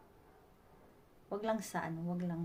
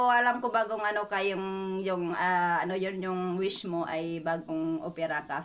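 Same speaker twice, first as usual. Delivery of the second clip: normal talking voice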